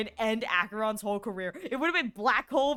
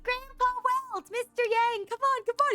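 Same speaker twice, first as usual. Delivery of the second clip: put-on high, excited children's voices, higher; eager kids calling; 13 semitones higher, 3 dB louder than usual. Kids urging grandpa Welt to hurry, high eager call. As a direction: call out like impatient little kids.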